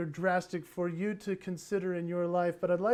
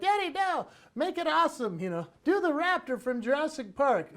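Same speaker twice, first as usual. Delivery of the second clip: put-on much higher, laughing child's protest; whiny kids; 6 semitones higher, 4 dB louder than usual. Kids telling dad to do the raptor, higher laughing protest. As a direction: demand it like an impatient kid. Kids voiced demanding scarier dinosaur.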